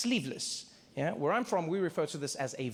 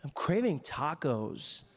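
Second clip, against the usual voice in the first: laid-back dude; 3 semitones higher, slower than usual. Retold or imitated.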